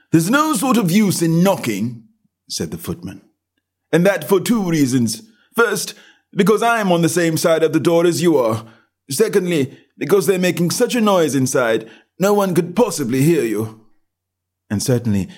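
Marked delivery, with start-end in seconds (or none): 0.1-2.1 high, indifferent servant voice; a bored doorman brushing her off; dull footman. A narrator's reading. Footman's voice in the story. 3.9-13.9 high, indifferent servant voice; a bored doorman brushing her off; dull footman. A narrator's reading. Footman explains to Alice.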